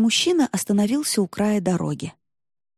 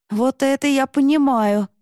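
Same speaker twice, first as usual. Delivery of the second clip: протяжное одобрение; 7 semitones higher, 5 dB louder than usual. A narrator's reading. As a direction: stretch it out with admiration. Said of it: "протянула Алёна". (одобрительно, нараспев)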